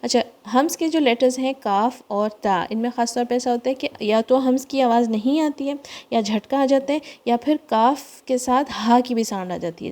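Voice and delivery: usual speaking voice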